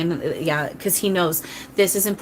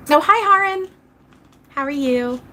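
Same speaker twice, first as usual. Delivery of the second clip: bright, high, louder hello; excited greeting; 9 semitones higher, 5 dB louder than usual. Real, not acted. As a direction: greet a friend who just showed up. Greeting a viewer in live chat.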